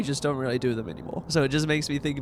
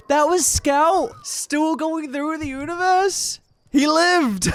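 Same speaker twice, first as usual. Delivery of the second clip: high, slow astonishment; amazed surprise; 12 semitones higher, 3 dB louder, slower than usual. Real, not acted. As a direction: gasp it out, astonished. Discovering old scout survived.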